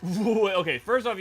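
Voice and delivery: high, loud "wait"; startled. Real, not acted. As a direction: stop them in shock. Startled by butthole remark.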